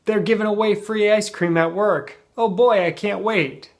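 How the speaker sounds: high, loud and gleeful; bubbling over with eager delight; playful excitement. Shown as a demonstration. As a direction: sound like a kid promised a treat. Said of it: "it's maybe a little more playful".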